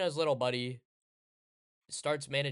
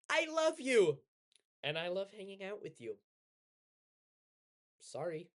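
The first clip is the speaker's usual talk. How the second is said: gentle friendly letdown; 6 semitones higher than usual. Retold or imitated. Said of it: "It's like".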